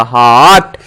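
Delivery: हट stretched low as "haawt"; long O sound. Shown as a demonstration. Teacher demonstrating the O sound, हट said as a long "haawt". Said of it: "last में ओ का sound निकलेगा".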